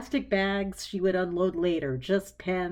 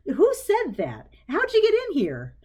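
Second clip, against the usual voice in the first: much higher, loud, frightened demand; alarmed; 9 semitones higher, 6 dB louder than usual. A narrator's reading. Clarissa alarmed, calling out to an unseen voice, high and loud. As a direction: call out to an intruder.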